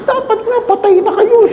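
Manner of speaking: exaggerated joking retort; mock teasing. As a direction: tease her with a cheeky punchline. Priest's teasing punchline.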